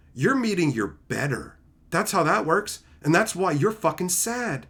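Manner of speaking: ordinary talk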